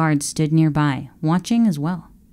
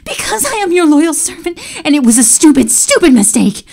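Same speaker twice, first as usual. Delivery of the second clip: high, loud, tearful pleading; crying servant pleading; desperate cry; 11 semitones higher, 5 dB louder than usual. Acted. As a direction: beg for mercy. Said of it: "Jasper cried".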